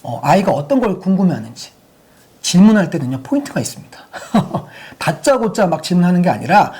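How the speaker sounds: ordinary talk, nothing marked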